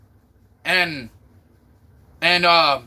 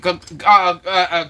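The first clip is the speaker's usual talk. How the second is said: ordinary delivery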